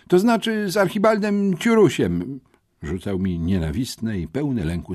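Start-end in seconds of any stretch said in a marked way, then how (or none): 0.1-2.4 flustered correction. A narrator's reading. Flustered pupil corrects himself to headmistress.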